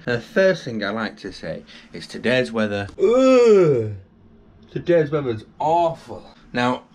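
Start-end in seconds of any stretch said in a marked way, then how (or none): 3.0-3.9 loud, high drawn-out ugh; a sharp, much higher and louder ugh of dismay; pained groan. Real, not acted. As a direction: groan in disgust at the weather. Groaning at the awful weather.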